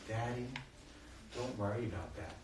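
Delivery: soft and low, retold child's comforting; gentle reassurance. Six-year-old son forgiving his father, softly reassuring. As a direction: console tenderly, hushed, with small pauses.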